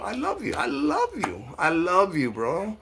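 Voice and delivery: sing-song high mock tenderness; mock affection. Real, not acted. Taunting a Trump fan in the comments, mock loving.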